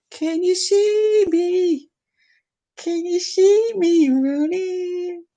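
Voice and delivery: very high, slow sing-song; high drawn-out creepy sing-song; high slow taunt. Pretend.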